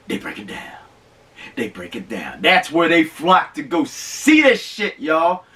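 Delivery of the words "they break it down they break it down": whispered then low and soft; hushed. Real, not acted. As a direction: lean in and say it like a secret. Hushed reverent repeat.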